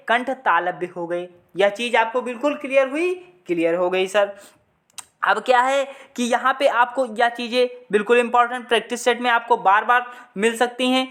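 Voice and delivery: normal talking voice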